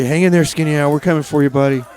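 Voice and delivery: normal talking voice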